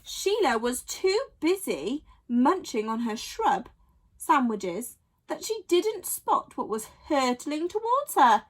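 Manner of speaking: usual speaking voice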